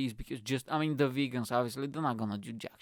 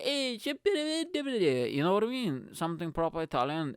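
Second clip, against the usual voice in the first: slow, drawn-out hammed-up Italian accent; mock Italian restaurateur, lazy and high; playful fake Italian accent; 4 semitones higher than usual. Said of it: "really stereotypical".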